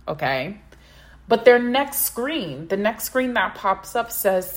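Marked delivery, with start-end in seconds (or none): none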